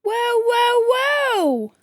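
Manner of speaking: high drawn-out whoa; placating chameleon. Acted. Chameleon calming the angry customers, high placating whoa. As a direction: hold up the crowd.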